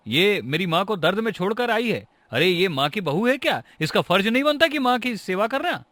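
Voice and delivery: high, heated protest; flaring up. Acted. Son-in-law complains to his mother-in-law about his wife, flaring up. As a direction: pitch climbing in indignant complaint. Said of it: "मैं भड़कू नहीं तो और क्या करूँ".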